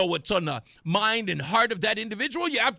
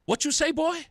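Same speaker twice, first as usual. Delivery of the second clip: confrontational challenge; 6 semitones higher than usual. Retold or imitated. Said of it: "I said".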